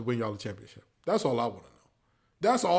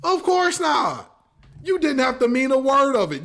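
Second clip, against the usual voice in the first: sarcastic; 10 semitones higher, 7 dB louder than usual. Real, not acted. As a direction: drag it out in mock disbelief. Sarcastic answer repeated.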